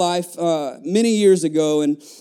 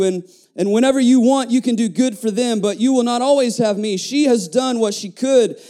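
raised, proclaiming preacher's read; emphatic scripture reading; 5 semitones higher than usual. Acted. Preacher reading Jesus's words to the congregation, emphatic scripture reading. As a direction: proclaim the verse, settling down at its close.

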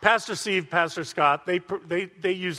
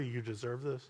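much softer, a cold little accusing voice; accusing inner voice; 5 semitones lower, 12 dB quieter than usual. Acted. Preacher voicing the accuser's inner voice, soft and cold. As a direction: murmur it like a sneering voice inside the head. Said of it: "Do you hear a voice come in sometimes say".

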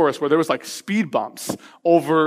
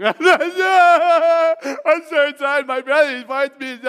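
bawling; 8 semitones higher than usual. Retold or imitated. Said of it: "I just start bawling".

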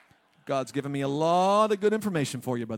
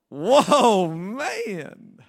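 high, loud astonished whoa; amazed whoa; 4 semitones higher, 8 dB louder than usual. Retold or imitated. Adam seeing Eve first time, loud amazed whoa. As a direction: gasp at the sight of her. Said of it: "Adam was like".

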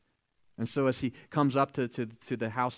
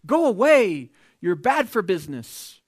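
angry townsfolk; 7 semitones higher, 9 dB louder, slower than usual. Acted. Angry townspeople chase Jesus off.

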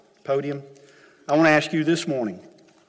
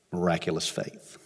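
softly and lower, "Miraculous faith" let fall; hushed awe; 8 semitones lower, 9 dB quieter than usual. Acted. Preacher reflecting on the martyr's faith, hushed and low. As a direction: breathe it out in reverence.

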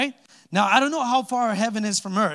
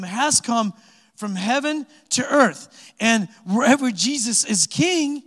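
higher, louder, slowed preaching climax; building preaching; 4 semitones higher, 3 dB louder than usual. Acted. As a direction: preach it out with rising force. Preaching builds on God's kingdom.

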